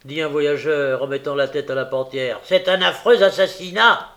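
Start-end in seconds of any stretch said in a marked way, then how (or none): 2.4-4.1 loud, high declaration; appalled traveller. A narrator's reading. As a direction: announce the horror emphatically. Traveller confirms the news.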